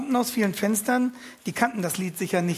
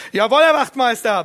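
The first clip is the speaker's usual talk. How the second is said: very loud, higher mock-military reply; loud shout back; 5 semitones higher, 10 dB louder than usual. Prisoner shouting back to the guard. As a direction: shout it back at full volume. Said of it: "brülle ich ebenso laut zurück".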